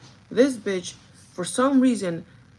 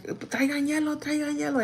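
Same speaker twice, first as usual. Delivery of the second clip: urgent call; 7 semitones higher than usual. Retold or imitated. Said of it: "I just like".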